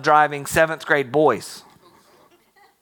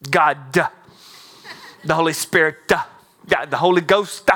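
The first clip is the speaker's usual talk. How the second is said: imitating an old-time preacher. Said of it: "always thought he had an A at the end of his name".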